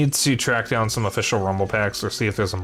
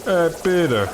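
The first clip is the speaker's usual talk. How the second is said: Family Guy impression; 5 semitones higher than usual. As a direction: greet Peter like the deadpan Family Guy neighbor. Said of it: "It's not the voice I'm trying to go for".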